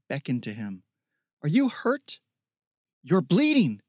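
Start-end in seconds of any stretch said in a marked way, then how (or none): 1.4-3.8 high, urgent worried voice; alarmed, pitched up and louder; alarmed concern. A narrator's reading. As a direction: cry out in concern for a hurt friend. Worried girl finds him hurt.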